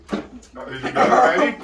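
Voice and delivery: high laughing disbelief. Real, not acted. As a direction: laugh it out, slow and loud. Laughing incredulous question.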